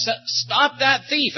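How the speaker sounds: high, slow imitated shout; mock yell. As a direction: stretch out a half-shouted call after a thief. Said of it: "and somebody yelled".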